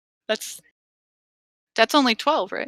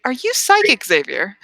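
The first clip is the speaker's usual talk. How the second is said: surprised; 3 semitones higher than usual. Acted. Playful accusation to NPC.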